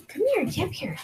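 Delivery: high, soft pet call; coaxing the dog. Real, not acted. Coaxing the dog onto her lap, high soft call. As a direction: call him up gently and high.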